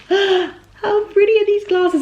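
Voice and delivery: gasp, then high, slow and thrilled; breathless squeal of delight. Real, not acted. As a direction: swoon over the glasses. Gasps at new glasses.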